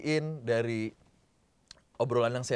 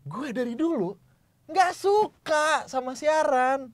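"nggak suka" drawn out high and loud; penekanan kuat; 13 semitones higher, 5 dB louder, slower than usual. Shown as a demonstration. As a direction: hit "nggak suka" hard, high and slow. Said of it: "pakai teknik penekanan itu". (tekankan "nggak suka" dengan nada tinggi)

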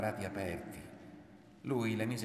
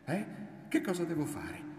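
much higher, pressing repeat of the question; insistent demand; 10 semitones higher than usual. A narrator's reading. Woman pressing her silent lover, high insistent demand. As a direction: press hard for an answer, pitched right up.

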